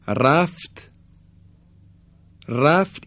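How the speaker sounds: R in رفت said heavy and thick. Shown as a demonstration. («ر» در رفت درشت و پُر)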